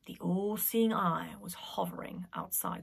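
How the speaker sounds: whispered suspense; hushed narration. A narrator's reading. Narrator whispering the tense moment, unvoiced. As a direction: whisper it, tension building.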